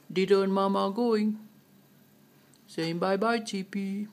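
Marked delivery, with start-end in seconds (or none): none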